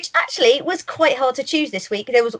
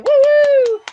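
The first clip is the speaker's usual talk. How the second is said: much higher, loud celebratory whoop; excited cheer; 8 dB louder than usual. Real, not acted. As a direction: cheer the winner like a game show host. Host cheers the award winner.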